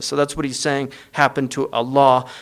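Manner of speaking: usual speaking voice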